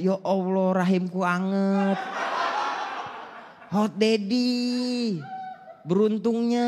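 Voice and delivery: dreamy mock swoon; swooning commenter. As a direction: gush like a smitten mom commenter.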